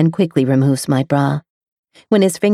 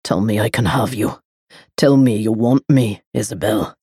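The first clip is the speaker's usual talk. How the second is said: hissed male demand; 7 semitones lower than usual. A narrator's reading. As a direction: hiss it into her ear.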